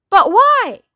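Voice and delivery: high, loud protest; shouted question. A narrator's reading. Everyone in the elevator questioning Wonka, shouted. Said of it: "they all shouted at once".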